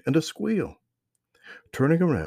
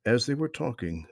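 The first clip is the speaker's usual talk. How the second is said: ordinary delivery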